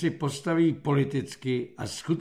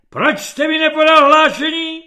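high, very loud shouted question; roaring demand; 11 semitones higher, 11 dB louder than usual. A narrator's reading. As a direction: bellow at a subordinate for his report. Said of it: "zařval generál". (generálský řev na podřízeného)

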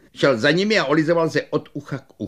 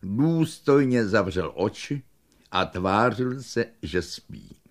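plain reading voice

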